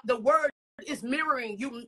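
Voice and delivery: ordinary delivery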